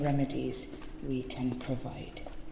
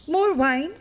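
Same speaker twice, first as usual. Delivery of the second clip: much higher and louder, chirpy; nun breaking the grim mood by offering the priest more wine; sudden bright offer; 11 semitones higher, 6 dB louder than usual. Acted. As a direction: snap back to cheerful hostess.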